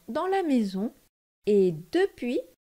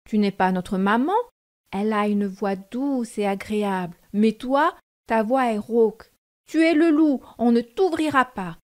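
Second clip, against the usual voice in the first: louder, firm refusal; defiant kids; 5 dB louder than usual. Acted. The seven kids refuse to open for the wolf, defiant. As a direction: shout back at the wolf.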